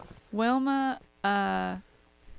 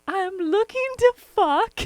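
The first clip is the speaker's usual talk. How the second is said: high, loud, slow put-on voice; prank caller voice; 10 semitones higher, 6 dB louder than usual. Daughter imitates mom's prank voicemail, loud high caller voice. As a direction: drag it out as a mock caller. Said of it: "snaps right into it".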